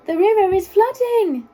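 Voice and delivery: high, loud squeaky warning; squeaky giraffe. A narrator's reading. Giraffe warns the worried animals, squeaky and urgent. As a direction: squeak out the alarm. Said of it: "in a surprisingly squeaky voice".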